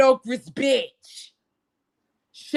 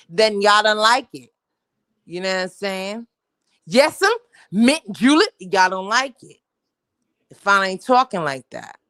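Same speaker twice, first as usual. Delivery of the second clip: ordinary talk, nothing marked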